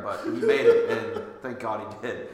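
laughing through the words. Real, not acted. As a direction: laugh it off with relief. Laughing after story.

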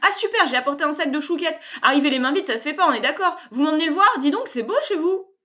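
loud, high, cheerful bluster; brash salesman. A narrator's reading. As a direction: barge in like an overfamiliar hustler. Sébastien's pushy voice.

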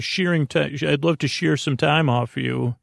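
ordinary talk, nothing marked

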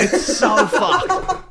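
high, slow, laughing. Real, not acted. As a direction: squeal it through laughter. Laughing at the milking joke.

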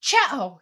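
loud acted sneeze; a loud sharp Chow of a sneeze. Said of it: "sneezed loudly".

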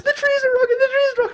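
very high panicky laughing wail; imitating a frightened girlfriend; scared wail. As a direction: squeal it in mock terror, half laughing. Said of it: "she's like".

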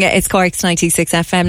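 normal talking voice

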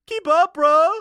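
dumb surfer. Acted. Ventriloquist tells police why he's at the hotel, dumb surfer voice. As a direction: pitch it up as a cocky beach bum. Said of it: "like he's some dumb surfer".

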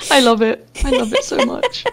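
high giggling through words; laughing. Real, not acted. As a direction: laugh while you say it. Laughing at friend's cackle.

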